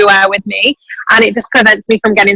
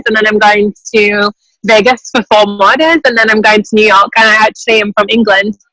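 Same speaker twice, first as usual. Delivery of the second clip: normal talking voice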